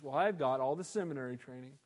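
low, soft, smug voice; proud Pharisee. Retold or imitated. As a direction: look down your nose at a fisherman. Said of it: "really proud of themselves".

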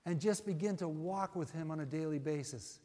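ordinary talk, nothing marked

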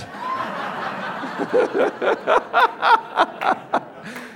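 a long, very high airy laugh; high breathy laugh. Real, not acted. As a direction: let out a breathless giggle. Laughing at his own joke.

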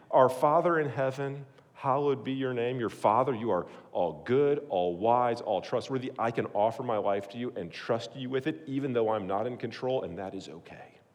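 low, soft prayer voice. Shown as a demonstration. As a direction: pray it quietly and humbly. Prays aloud as example.

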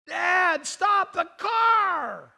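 high, slow, loud shout to dad; shouted plea. Retold or imitated. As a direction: yell for dad to stop the car. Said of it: "she screams out".

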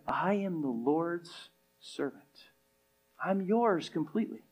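a quiet, tender devotion; humble surrender. Retold or imitated. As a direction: yield yourself gently like Mary. Mary's humble answer.

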